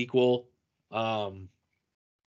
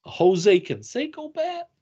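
higher, louder, slow incredulous cry; movie quote; 10 semitones higher, 5 dB louder than usual. Retold or imitated. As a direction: squeak it in disbelief. Movie quote, high outburst.

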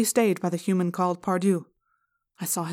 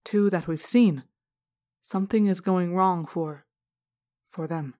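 ordinary delivery

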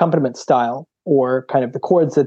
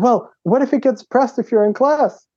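worried principal; 9 semitones higher than usual. Retold or imitated. Principal voiced in retold story.

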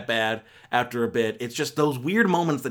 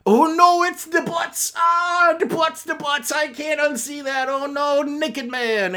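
very high, hammed-up squeal of horror; mock panic; 12 semitones higher, slower than usual. Reviewer mocking juvenile film humour, mock panic. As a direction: shriek in pretend shock, way up high. Mocking the film's butt jokes.

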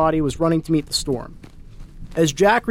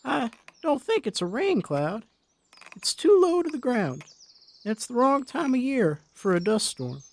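put-on high, soft, worried old woman; an old lady spotting danger; frightened old woman; 7 semitones higher, 3 dB quieter than usual. A narrator's reading. Old wife, alarmed at cloud.

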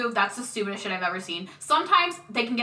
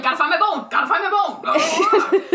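loud, hammed-up dog-man chant, higher; horrible dog voice; 6 semitones higher, 6 dB louder than usual. To the maids, a silly loud dog voice. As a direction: bark it like a man possessed by a dog. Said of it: "this horrible voice".

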